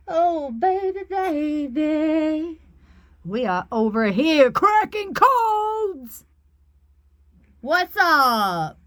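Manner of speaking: high, drawn-out excited crowing; playful celebration. Real, not acted. Reader celebrates cracking codes with viewers, gleefully excited. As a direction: crow it slowly, swooping up high.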